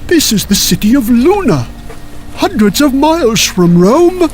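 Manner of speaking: baffled, can't believe the mistake; incredulous bishop. Acted. Bishop reveals it is not Rome.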